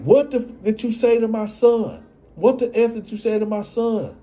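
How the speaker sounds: high, heated accusing voice; mock furious mother; angry mock confrontation. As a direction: come at him about your son. Baby mama confronting him.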